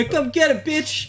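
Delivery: high-pitched cocky challenge; mock tough taunt. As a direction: throw down a playful dare. Acting out calling the bluff.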